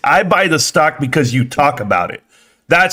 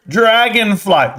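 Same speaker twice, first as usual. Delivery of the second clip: normal talking voice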